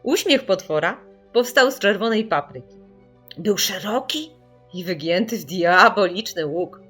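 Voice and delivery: plain reading voice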